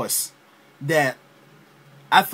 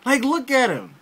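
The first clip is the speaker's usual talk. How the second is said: incredulous; 7 semitones higher than usual. Retold or imitated. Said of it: "I'm sitting there like".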